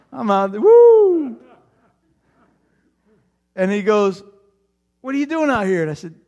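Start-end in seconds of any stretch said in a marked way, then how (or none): 0.1-1.4 excited whoop. Retold or imitated. Boy playing catch at midnight. 5.0-5.8 dad calling out. Retold or imitated. Dad asks again, higher.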